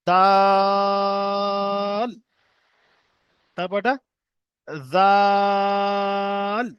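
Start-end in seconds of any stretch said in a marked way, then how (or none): 0.1-2.1 দাল held long with rounded mouth, fish-cheek shape; long drawn dal. Shown as a demonstration. Teacher drawing out দাল for students. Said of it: "ঠিক মাছের গালের মতো". 4.9-6.7 জাল held long and light, tongue tip to upper teeth; long drawn zal. Shown as a demonstration. Teacher showing students the light জাল. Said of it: "এটা যেন কেউ জাল না পড়ে দিই".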